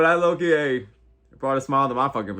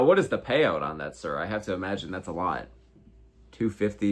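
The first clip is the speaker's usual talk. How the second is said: ordinary talk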